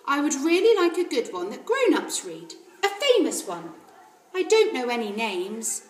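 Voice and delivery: a hint of a young girl asking politely; Matilda. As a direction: ask like a keen small girl. Narrator voices Matilda asking.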